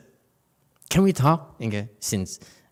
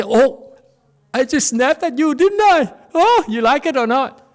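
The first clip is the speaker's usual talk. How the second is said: high, loud hammed-up apology; mock apologetic worker; 9 dB louder than usual. Teacher imitates a worker apologizing after snapping at someone. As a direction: overplay a sheepish sorry. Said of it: "and you say".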